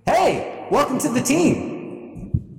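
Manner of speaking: high, drawn-out telepathic greeting; cheery bartender character. Acted. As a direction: welcome them in a high put-on voice. Psychic bartender greets new teammate telepathically.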